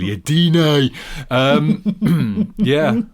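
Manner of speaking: a weak, lightly attempted Geordie accent; bad Geordie impression. Apologising to Newcastle listeners for a poor Geordie accent. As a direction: try a Geordie accent and half miss it. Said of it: "absolutely shit-awful impression of a Geordie accent".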